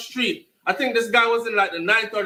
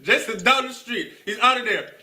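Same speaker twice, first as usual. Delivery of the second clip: laughing while talking. Real, not acted. Storyteller recalls friend running off, laughing as he talks. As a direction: talk through real laughter.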